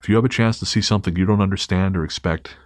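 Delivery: usual speaking voice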